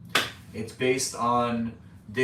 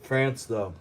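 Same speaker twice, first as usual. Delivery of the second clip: usual speaking voice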